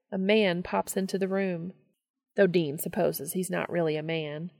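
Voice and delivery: plain reading voice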